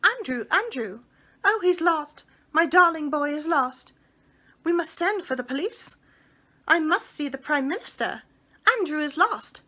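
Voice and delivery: high, loud panicked cries; calling out in a flap for a lost dog; frantic calling. Acted. Miss Lark calls for her lost dog, high and loud panic. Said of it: "waving her arms and calling".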